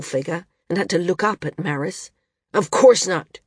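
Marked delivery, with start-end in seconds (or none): 2.5-3.4 curt, irritated retort, louder; short snapping reply, louder; snapped. A narrator's reading. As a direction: bite the words off sharply. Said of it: "he snapped".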